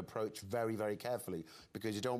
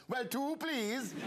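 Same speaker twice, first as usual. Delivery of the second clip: very high, drawn-out mock Welsh; copycat Welsh accent; much slower than usual. Guest instinctively copying the Welsh receptionist, high slow Welsh accent. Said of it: "Hear an accent and just suddenly start talking like that?".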